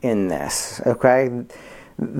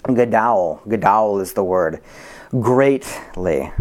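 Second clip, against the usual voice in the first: normal talking voice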